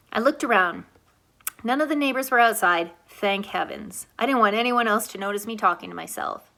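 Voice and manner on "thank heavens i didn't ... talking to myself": ordinary delivery, nothing marked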